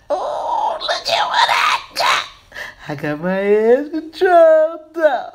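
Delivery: high squealing delight over the edge control; thrilled falsetto cries; excited squeal. Real, not acted. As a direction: squeal with real joy, pitch way up. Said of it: "I'm so excited".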